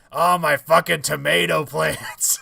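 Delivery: loud, high drawn-out yell; imitated yell. Imitating a game character's line for the co-host, yelling. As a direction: holler it in mock outrage, stretching the words.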